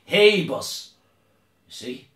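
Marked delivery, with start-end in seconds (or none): 0.1-0.9 loud, high call with a long first word; acted holler, stretched out; shouted hail. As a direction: shout after someone walking away. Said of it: "Jesus shouted at him".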